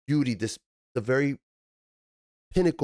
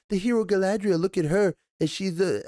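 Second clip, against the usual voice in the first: high, hammed-up storybook announcer; sing-song mock herald; mock puppet-play narrator; 9 semitones higher than usual. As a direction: parody the cheesy puppet show. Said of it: "like, a puppet play".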